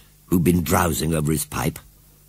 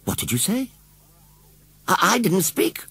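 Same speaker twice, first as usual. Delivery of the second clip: put-on child's voice; 11 semitones higher than usual. Children speak in story.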